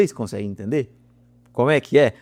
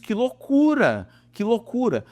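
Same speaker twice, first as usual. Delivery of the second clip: much higher, slow "Que loucura"; amazed; 10 semitones higher, slower than usual. Real, not acted. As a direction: marvel at it, drawing it out. Marvels at the mechanism. (espanto lento e agudo)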